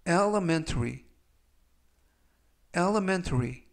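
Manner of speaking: elementary with the stress on the middle E, el-e-MEN-ta-ry. Shown as a demonstration. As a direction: hit the middle syllable of elementary. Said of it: "강세는 가운데 부분에 있는 알파벳 E". (elementary의 가운데 E에 강세)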